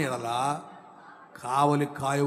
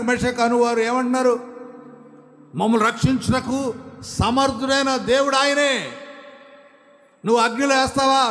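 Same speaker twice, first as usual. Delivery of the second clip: higher and louder declaration; full-force preaching; 9 semitones higher, 6 dB louder than usual. Acted. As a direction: proclaim it to the whole church. Preaching God's might. (ఉద్రేకంతో ప్రకటన)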